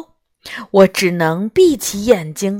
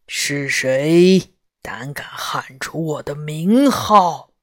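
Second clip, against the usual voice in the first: low growling roar; 6 semitones lower than usual. Acted. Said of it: "用低沉的声音吼道".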